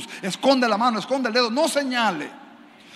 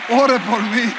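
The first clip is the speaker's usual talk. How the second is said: hermana suplicando; 3 semitones higher, 9 dB louder, slower than usual. Retold or imitated. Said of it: "repite burlándose de la hermana".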